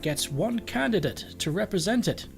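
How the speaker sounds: a fussy English butler, indignant by the end; posh English butler. Acted. Kale the cat explains the new election laws, posh English butler voice. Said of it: "Kale has taken on the sort of voice of".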